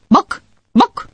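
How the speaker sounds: high clucking chicken voice; a chicken saying bok bok. Acted. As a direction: cluck it like a hen at the desk. Chicken talks to librarian.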